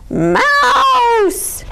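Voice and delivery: high, loud exasperated shout; loud call. A narrator's reading. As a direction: catch the little thief. Bear catches Mouse.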